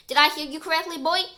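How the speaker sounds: loud, affronted challenge. A narrator's reading. Professor glaring at Terry after the insult, affronted. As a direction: demand it sternly and loudly. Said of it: "glaring at Terry".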